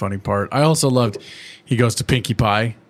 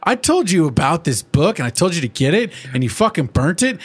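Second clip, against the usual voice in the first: hammed-up scolding; angry pony rant at Spike; mock angry Twilight; 6 semitones higher than usual. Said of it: "She's like".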